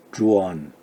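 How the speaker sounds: 주원 with a weak o slipped in after u; teacher showing the correct 주원; correct pronunciation demo. Shown as a demonstration. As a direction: model 주원, gliding through a light o. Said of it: "어 발음을 약간 넣어서 주원".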